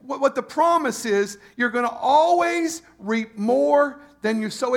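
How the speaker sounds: full-force preaching. Acted. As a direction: preach it out to the congregation. Preacher stressing the promise.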